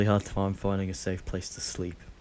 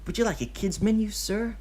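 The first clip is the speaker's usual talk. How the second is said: high, louder sweet waitress voice; polite hostess; 13 semitones higher, 4 dB louder than usual. A narrator's reading. Hostess offering Obito a kids menu, high polite voice. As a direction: greet the customer like a cheerful hostess. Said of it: "the hostess says".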